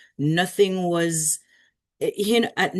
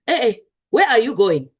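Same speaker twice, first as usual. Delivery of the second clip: put-on loud Nigerian teacher scolding, in Nigerian accent; 5 semitones higher, 7 dB louder than usual. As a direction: call out sharply like a Nigerian teacher catching a student. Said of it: "that was my best Nigerian".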